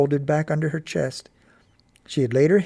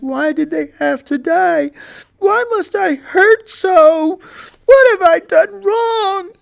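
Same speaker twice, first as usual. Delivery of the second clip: high, strained and slow, near tears; boy choking back sobs at his dog's grave; 10 dB louder, slower than usual. Acted. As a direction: force the words through a tight, crying throat. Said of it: "In a cro-, choking voice".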